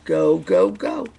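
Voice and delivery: loud chanted yell; storyteller's punchy crowd yell. A narrator's reading. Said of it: "yelled the crowd".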